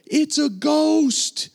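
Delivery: high, loud, drawn-out cry of terror; terrified cry. Acted. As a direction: cry out in fright, stretching the words high. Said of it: "They were terrified".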